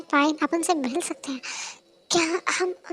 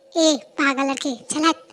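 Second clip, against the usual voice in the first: normal talking voice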